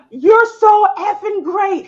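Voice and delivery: put-on little boy praising classmates, slow and loud; kid cheering others. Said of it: "he's like".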